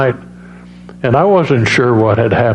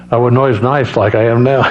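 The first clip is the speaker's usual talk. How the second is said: normal talking voice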